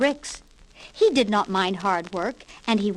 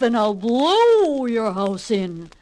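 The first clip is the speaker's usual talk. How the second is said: slow, louder growling threat; angry wolf; 4 dB louder, slower than usual. Acted. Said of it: "cried the angry old wolf".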